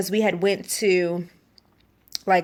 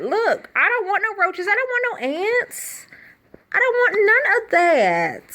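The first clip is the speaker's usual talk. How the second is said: much higher, exasperated protest; high exasperated complaint; 10 semitones higher than usual. Retold or imitated. High-pitched protest to roommate about roaches.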